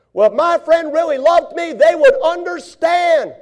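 high, loud, wounded complaint; hurt and demanding; hammed-up sulker; self-pitying whine. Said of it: "Wrong answer".

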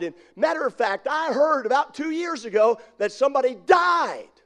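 rising, panicky, high voice; panicky nervous rider. Acted. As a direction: build from worry to panic. Nervous man in line acted.

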